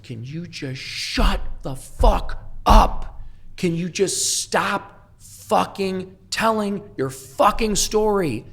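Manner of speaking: high, slow, furious tirade, imitated; angry yelling. Retelling how his sponsor Robbie yelled at him, high and slow. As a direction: mimic a sponsor losing his temper, high and drawn out. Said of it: "he's red in the face screaming at me".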